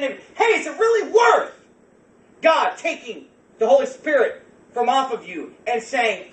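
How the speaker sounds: high, loud, building to a shout; fired-up preaching. Real, not acted. Preacher confronting congregation about filthy DVDs, fired-up loud preaching. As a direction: thunder it out at the congregation.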